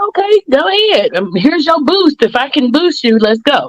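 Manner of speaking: high, bright cheer that settles back down; retold hype. Cheering a friend toward her next goal, high excited boost. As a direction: pump her up like a hype woman.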